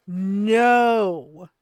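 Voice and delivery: loud, long-held "No"; emphatic refusal. Shown as a demonstration. Streamer refusing unasked game advice, loud drawn-out refusal. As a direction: bellow one stretched-out no. Demonstrating her planned reply to backseaters.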